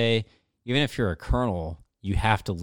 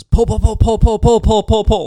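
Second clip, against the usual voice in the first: very high, very loud frantic repeated pull; urgent shouted pull; 14 dB louder than usual. Retold or imitated. Backseater yelling over the intercom to pull up, frantic shout. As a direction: scream it like the jet is about to hit a no-fly zone. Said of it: "He just goes".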